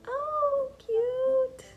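high, loud delighted squeal; excited squeal. Real, not acted. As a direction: gush over something adorable. Delighted at the cute cat.

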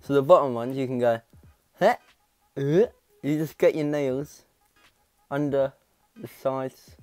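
lips pulled apart by fingers; talking while removing retainer; fingers in mouth. Real, not acted. Seen 0: Both hands' fingers hook lower retainer inside mouth throughout labelled words.

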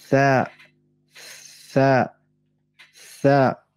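"Tha" mit Zunge zwischen den Zähnen; "Tha" wie ein gelispeltes T; lispelndes Tha. Shown as a demonstration. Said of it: "Versucht das mal nachzumachen". ("Tha" lispelnd, Zungenspitze sichtbar)

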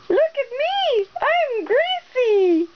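put-on very high, slow baby voice; baby voice like Gracie. Mother imitating baby Gracie for her toddler, high slow baby voice. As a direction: coo like a little baby. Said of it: "I can be a baby, just like Gracie".

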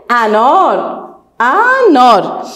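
drawn-out word, syllable by syllable; slow sounded-out word. Shown as a demonstration. Teacher spelling a word aloud for children, slowly. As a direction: say it slowly for the class. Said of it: "حرف، آفرین".

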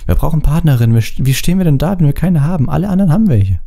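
high, exasperated appeal; impatient. A narrator's reading. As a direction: plead your case impatiently. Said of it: "sagte Ron ungeduldig".

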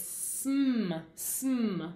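"sm" said as one blended sound, then again; blend sound demo. Shown as a demonstration. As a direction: sound out "sm" for the children. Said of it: "So that's a blend".

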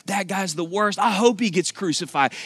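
high-pitched acted cursing; hammed-up sputter of someone cussing a man out; mock cussing. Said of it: "He starts cussing".

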